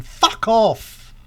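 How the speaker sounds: loud, slow, drawn-out fuck off; mock shouted sign-off. Sign-off telling viewers who didn't like it: loud, slow mock shout. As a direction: bellow it out at the viewers, half joking.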